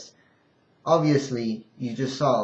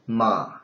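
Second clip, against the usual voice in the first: MA dipping low; third tone; 3 semitones lower than usual. Shown as a demonstration. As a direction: let MA sink to the bottom of the voice. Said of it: "in the third intonation".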